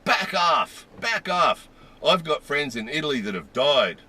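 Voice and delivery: mock-furious warning, voice jumping up; retold angry shout. Angry shopper warning off customers behind her, retold as a shout. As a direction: imitate her loud, high-pitched outburst. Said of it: "she said this really loudly".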